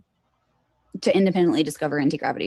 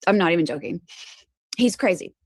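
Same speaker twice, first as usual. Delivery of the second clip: ordinary talk, nothing marked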